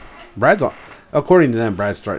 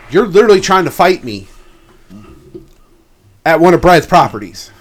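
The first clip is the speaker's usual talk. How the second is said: much higher, louder angry rant; indignant outburst; 7 semitones higher, 10 dB louder than usual. Real, not acted. Venting about a coworker who tried to fight him, angry outburst. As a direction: blow up at the absurdity.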